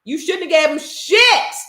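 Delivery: high, loud, slowed indignation; exasperated outburst. Real, not acted. Mother reacting to daughter's four-dollar tip, high exasperated outburst. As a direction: jump up in pitch and hammer it out. Outraged reply about the tip.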